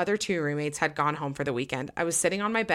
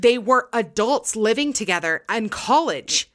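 slow and louder, setting it straight; insistent correction; 5 semitones higher, 6 dB louder, slower than usual. Real, not acted. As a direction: firmly correct them, word by word. Correcting the listener's wrong picture.

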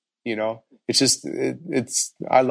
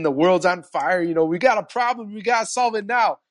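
mock frantic panic; 6 semitones higher than usual. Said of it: "and he's, he's cursing".

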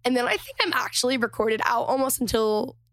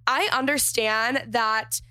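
ordinary delivery

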